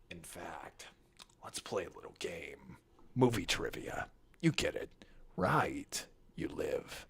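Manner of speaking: low, whispery menacing villain; sultry Riddler. Acted. Villain threatening a contestant in his movie, sultry whisper. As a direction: toy with your victim in a low hush. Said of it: "sultry Riddler voice".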